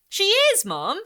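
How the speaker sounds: put-on high, loud whiny little girl; insist, pleading to be believed; insistent child. A narrator's reading. Girl insisting to mum, high and whiny.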